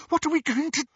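high-pitched panicky squeak. A narrator's reading. Hermione asks Harry what to do, squeaking. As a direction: squeak it out, cut off mid-question. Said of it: "squeaked Hermione".

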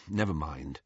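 low, quiet dismissal; giving up. Acted. Harry abandons explaining the Seeker to Hermione, giving up. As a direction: drop it softly and let it go. Gives up after being ignored.

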